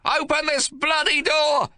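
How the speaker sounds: high, loud, slow bellow at the locked door; furious shout. Acted. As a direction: roar it at the door, raging. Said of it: "he shouts".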